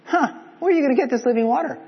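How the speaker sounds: high, loud scoffing sneer; snarky retort. Shown as a demonstration. Preacher mocking the woman's reply to Jesus, snarky. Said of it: "snarky here".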